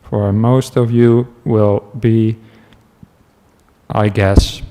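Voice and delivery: normal talking voice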